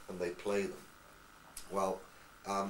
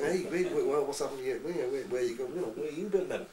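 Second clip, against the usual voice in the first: higher, sing-song Scouse; Scouse accent; 4 semitones higher than usual. Retold or imitated. Liverpool mates quizzing the returning traveller, Scouse accent. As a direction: imitate quick questioning Liverpool patter. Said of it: "when I was at Liverpool".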